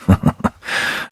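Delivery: short, voiceless chuckle; scornful chuckle. Acted. Knight mocking the girl's rescuer, scornful chuckle. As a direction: chuckle under the breath in scorn.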